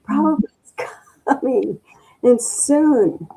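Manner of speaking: high, breathless joy breaking into a laugh; repeating the girl's joyful cry; excited shout with laugh. Retold or imitated. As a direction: keep shouting the news, laughing with delight. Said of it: "a girl running up the stairs screaming".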